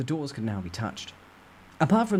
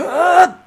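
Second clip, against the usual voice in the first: sharp high intake of breath; effortful gasp; loud strained gasp; 15 dB louder than usual. Acted. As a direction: gasp hard before the effort. Deep breath before pushing door.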